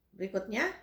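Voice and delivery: normal talking voice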